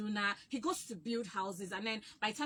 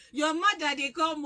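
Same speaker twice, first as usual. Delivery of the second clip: loud warning call, pitched up; 5 semitones higher, 8 dB louder than usual. Retold or imitated. Neighbourhood kids warning that her mother is coming, called out loud. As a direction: shout it across the yard, laughing. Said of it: "you hear".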